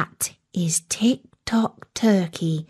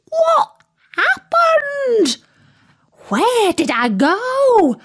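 very high, stretched-out bewildered squawk; turkey squawk; slower than usual. A narrator's reading. Befuddled turkey asking friends what happened, squawking. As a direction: squawk slowly in high-pitched confusion. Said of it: "he squawked".

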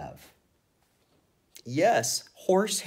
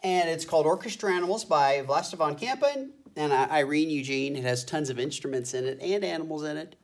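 ordinary delivery

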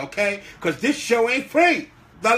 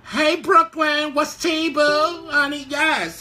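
excited greeting; 8 semitones higher, slower than usual. Real, not acted. Greeting Brooklyn in the live comments, excited. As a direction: sing out a welcome to a friend who just joined.

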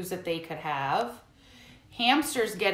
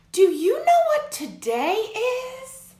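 character voice; 7 dB louder, slower than usual. Acted. Friend character asks in story.

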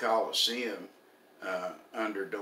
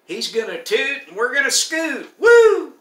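high, very loud preacher's whoop; 16 dB louder than usual. Retold or imitated. Preacher imitating his late mentor on the rapture, high exuberant holler. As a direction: belt it out high, climbing to a piercing woo. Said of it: "and he would say".

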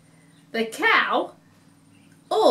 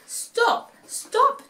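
high clucky chicken call; a high hen's stop; high chicken squawk; 11 semitones higher than usual. A narrator's reading. Chicken voiced in story.

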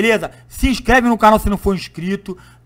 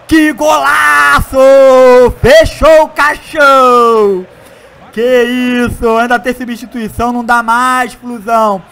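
play-by-play commentary; 4 semitones higher, 7 dB louder than usual. Acted. Commentary celebrating the win.

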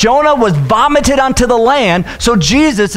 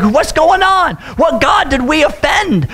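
higher, shouted panic on the rocking boat; panicked sailors; 6 semitones higher than usual. Acted. Sailors shouting in the storm, higher panicked yelling. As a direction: yell it like confused sailors in a storm.

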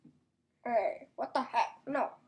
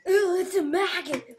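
ordinary talk

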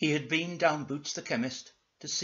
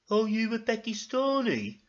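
high character voice; nosy, raised-pitch question; Ermintrude; 9 semitones higher, 4 dB louder than usual. Acted. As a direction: put on a high lady's voice. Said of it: "said Ermintrude".

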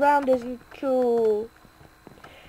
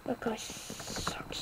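whisper; 16 dB quieter, much slower than usual. Real, not acted. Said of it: "unvoiced aside".

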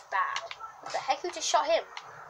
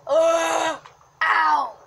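shout; 3 semitones higher, 7 dB louder than usual. Real, not acted. Hit in game.

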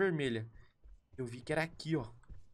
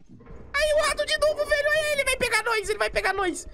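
shrill panicked yelling, far higher and louder; 5 dB louder than usual. Real, not acted. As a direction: freak out as the rat comes. Giant rat appears again. (pânico gritado)

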